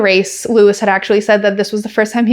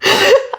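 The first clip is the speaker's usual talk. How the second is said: high laugh; 11 semitones higher than usual. Real, not acted. Laughing at own story.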